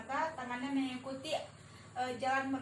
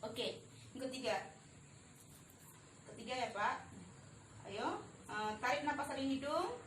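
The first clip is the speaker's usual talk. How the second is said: ordinary talk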